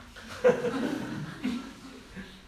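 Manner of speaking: 轻声长笑慢慢落下; 忍不住笑出气声; airy falling laugh. Real, not acted. Laughs after quoting son. (讲到孩子的话时笑出气声)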